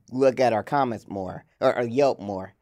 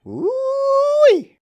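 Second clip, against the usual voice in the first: high, loud whoop; excited whoop; 6 dB louder than usual. Acted. Excited break call.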